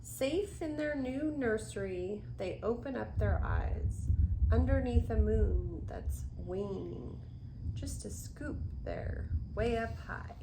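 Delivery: put-on gentle rhythmic read-aloud for children; storybook reading. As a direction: read the rhyme to little ones. Reads picture book aloud.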